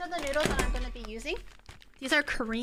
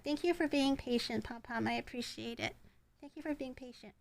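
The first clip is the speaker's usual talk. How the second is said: soft praise to dog; 9 dB quieter than usual. Real, not acted. Thanking the waiting dog softly.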